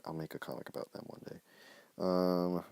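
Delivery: usual speaking voice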